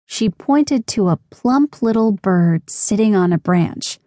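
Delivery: plain reading voice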